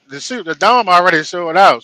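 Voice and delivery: ordinary talk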